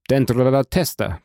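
ordinary delivery, nothing marked

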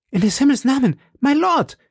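very high, urgent woman's cry; alarmed cry. A narrator's reading. Madame Bonacieux rushing between the fighters, alarmed cry. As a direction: cry out high to stop them. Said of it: "rief Madame Bonacieux".

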